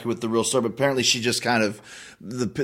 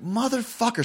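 retold fury; 9 semitones higher than usual. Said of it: "So I was furious".

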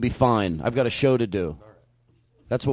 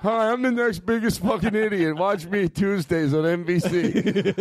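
laughing TV-show announcer; TV promo voice; 7 semitones higher, slower than usual. Pretend. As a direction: pitch it like a cheesy show ad. Mock reality show promo.

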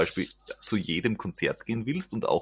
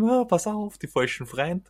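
mother warning; 10 semitones higher, 3 dB louder than usual. Retold or imitated. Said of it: "wovor mir meine Mutter jahrzehntelang gewarnt hat und gesagt hat".

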